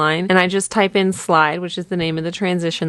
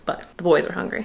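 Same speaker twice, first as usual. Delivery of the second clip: ordinary talk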